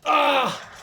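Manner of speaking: high wailing "ah"; crying scream. Real, not acted. Player in the game, crying scream. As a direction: wail out one loud cry.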